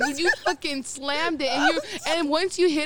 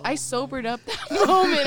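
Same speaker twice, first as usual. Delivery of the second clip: usual speaking voice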